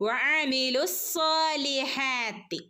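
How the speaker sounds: slow, heavy സ്വാ, no stop; joined recitation. Shown as a demonstration. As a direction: run വഅമിലു into സ്സാലിഹാത്തി. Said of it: "നമ്മളിവിടെ എങ്ങനെ ഓതും". (കൂട്ടിച്ചേർത്ത് ഓതുക)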